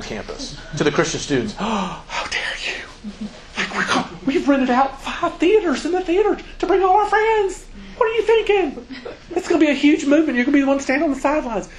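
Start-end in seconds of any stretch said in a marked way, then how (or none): 1.6-3.3 breathy, slow, scandalised whisper; a scandalised hush; mock outraged whisper. Mocking offended students' reaction. 3.6-11.7 much higher, gushing, faster toward the end; hammed-up high excited voice; mock zealous student.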